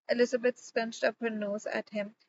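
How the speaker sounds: ordinary delivery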